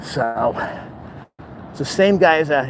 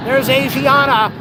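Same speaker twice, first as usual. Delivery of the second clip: excited call; 9 semitones higher than usual. Real, not acted. Vlogger spotting a jet, excited call. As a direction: point out the airline with delight.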